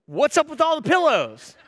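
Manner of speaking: high-pitched incredulous challenge; acted-out tactless husband's complaint; not so kindly. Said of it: "not so kindly, say".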